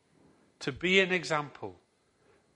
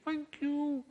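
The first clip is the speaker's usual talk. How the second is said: much higher, tiny voice for a fish; high little fish voice. Acted. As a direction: make it a small magical fish piping up. Talking fish thanks the man.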